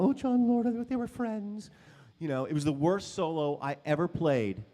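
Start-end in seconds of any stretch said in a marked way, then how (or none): 0.0-1.7 mock crowd murmur. Crowd sighing, high and loud.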